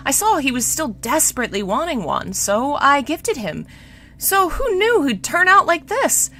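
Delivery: high-pitched regretful queen; acted plaintive, fretting mother; sad sighing. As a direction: lament with audible sighs between thoughts. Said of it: "She sighed sadly".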